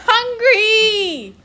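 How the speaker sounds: high, loud shriek. A narrator's reading. The child shrieks at the fleeing boys, ghostly shriek. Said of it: "you shrieked".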